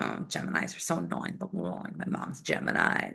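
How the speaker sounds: breathy whispered gripe; whispered complaint. Retold or imitated. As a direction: whisper it like a confession. Retold younger self's complaint.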